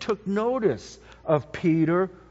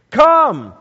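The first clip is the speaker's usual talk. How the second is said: calling out; 5 semitones higher, 10 dB louder than usual. Acted. Jesus calls Peter out.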